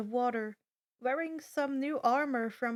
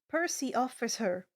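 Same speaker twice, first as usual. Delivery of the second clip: usual speaking voice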